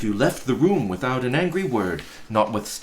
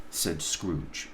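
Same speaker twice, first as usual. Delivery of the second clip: ordinary delivery, nothing marked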